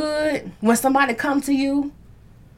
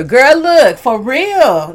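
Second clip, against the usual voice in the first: ordinary delivery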